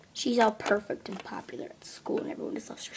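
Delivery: fast jealous whisper; whispered grumble. Acted. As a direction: mutter it under your breath. Muttering complaint about sister.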